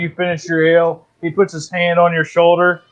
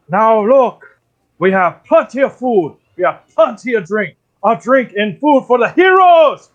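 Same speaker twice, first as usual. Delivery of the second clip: raised-pitch bear proclaiming a feast; hearty bear toast; 5 semitones higher than usual. Acted. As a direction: proclaim it to the hall, swelling toward the last word. Bear toasts the heroes in game.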